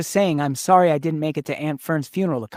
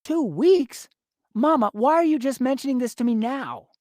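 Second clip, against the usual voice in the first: sharp, rising disbelief; can't believe what he hears; alarmed disbelief; 6 semitones higher than usual. Acted. Son alarmed about sister.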